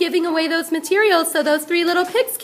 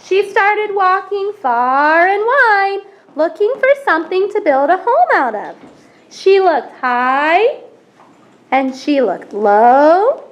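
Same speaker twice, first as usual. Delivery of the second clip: storytelling narration; 2 semitones higher, 5 dB louder than usual. A narrator's reading. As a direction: tell it big to the audience. Teacher narrates the play loudly.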